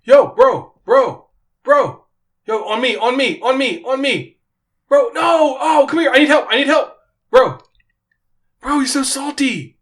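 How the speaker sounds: high, loud excited gamer shouting; gamer kid impression. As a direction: yell like a tween gaming online. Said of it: "impression of my son playing Fortnite".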